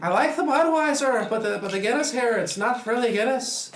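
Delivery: hammed-up leprechaun Irish voice; Irish accent. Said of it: "I almost got the accent down".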